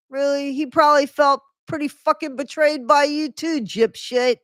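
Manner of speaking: high-pitched, incredulous scolding; scornful heckle. Real, not acted. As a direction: throw it back at her with open scorn. Heckles the memoir she reads aloud.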